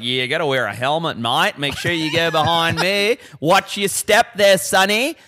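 hammed-up Australian safety nag; Australian accent. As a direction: put on a broad Aussie warning. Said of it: "middle-aged Australian dudes, and they're like".

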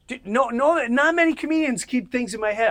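high and loud insistence; emphatic praise. Real, not acted. Praising the comedian to his friend, excited and emphatic. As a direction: push it hard, like it's obvious.

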